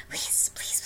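breathless, whispered begging; pleading whisper. Acted. A woman begging her lover in a whisper.

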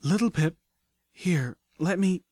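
high, halting offer of help; worried and winded, in slow broken phrases; alarmed, weak concern. A narrator's reading. Medic pony offers to treat injured friend, alarmed. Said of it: "looked at me with alarm".

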